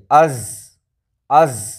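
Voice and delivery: از with air flowing out after the z; از said with a long, breathy, open z; ze sound with air. Shown as a demonstration. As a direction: let the breath keep passing through the z of از. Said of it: "مطلب ایئر پاس ہونا چاہیے".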